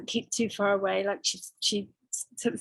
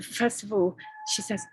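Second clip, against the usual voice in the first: ordinary delivery, nothing marked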